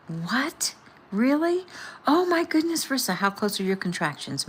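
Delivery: shocked squeal of surprise; surprised. A narrator's reading. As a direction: pitch leaps up in stunned disbelief. Sister hears labour has begun.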